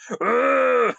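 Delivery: high, strained angry grunt; mock temper-tantrum outburst; tantrum roar. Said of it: "throws this weird temper tantrum".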